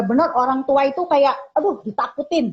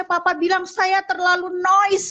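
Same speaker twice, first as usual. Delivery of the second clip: high, slow imitated kid voice; mock-plaintive child, pitched up; child's complaint; 8 semitones higher than usual. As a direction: play a hurt child reporting on papa. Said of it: "dia bilang".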